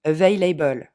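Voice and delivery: the typical French mistake on available; French-style available. Shown as a demonstration. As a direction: say available with a full, stressed able at the end. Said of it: "La faute que tous les Français font". (available avec able accentué à la française)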